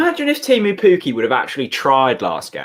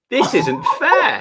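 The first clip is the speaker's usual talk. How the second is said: mock complaint; 8 semitones higher, slower than usual.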